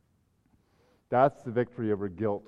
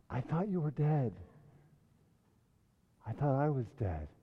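hushed echo; 7 dB quieter than usual. A narrator's reading. Pastor re-quotes softly.

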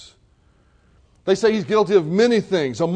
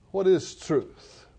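slow, softer, disgusted; mild sneer; 4 dB quieter, much slower than usual. Retold or imitated. Pilate sneers at Jesus, mild disgust. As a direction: drag it out with quiet contempt. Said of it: "You can see his disgust. He's almost sneering".

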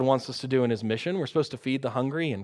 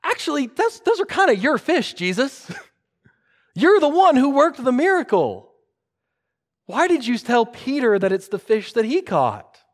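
high-pitched, playful quibble; mock technical objection; 9 semitones higher, 4 dB louder than usual. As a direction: object like a nitpicker, pitched well up.

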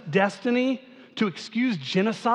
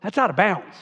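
mock alarm; 3 dB louder than usual. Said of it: "we would say".

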